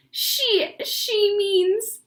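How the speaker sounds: can barely get it out for laughing; laughing hard. Acted. Beezus to the family, helpless with laughter. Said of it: "shouted with laughter".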